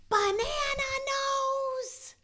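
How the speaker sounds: high, slow, indignant shriek; Snoopy repeats the insult, shrieking high and slow; outraged Snoopy. Acted. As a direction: squeal it in cartoon outrage. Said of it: "And Snoopy goes".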